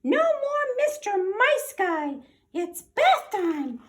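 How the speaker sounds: high, slow squeaky threat; officer threatening the dog, slow squeaky; squeaky tough guy. Acted. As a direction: drawl it slow like a tiny action hero.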